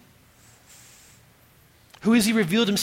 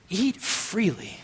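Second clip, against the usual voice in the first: "Eat freely" drawn out slowly; slow emphasis; 4 dB quieter, slower than usual. Acted. Preacher repeating God's first command to Adam, slow and emphatic. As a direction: stretch both words of "Eat freely", savoring them.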